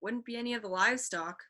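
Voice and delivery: plain reading voice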